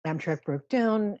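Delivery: usual speaking voice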